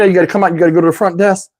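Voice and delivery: ordinary talk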